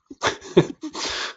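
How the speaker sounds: quick breathy burst of laughter; short laugh. Real, not acted. Teacher laughing at her oversized drawing brush, a short real laugh. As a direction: laugh at the clumsy big brush.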